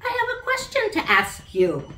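put-on high, loud child voice, polite and eager; little girl on the phone. A narrator's reading. Sally calls the princess to ask a favour, high and eager. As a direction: speak up like a small girl making her first call.

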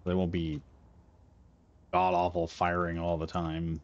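normal talking voice

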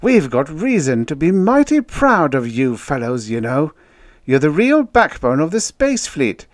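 high, loud, proud speech; rousing officer. A narrator's reading. Officer praising the men of the outposts, rousing. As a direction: give a pep talk pitched high and forceful.

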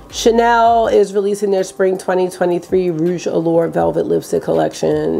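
usual speaking voice

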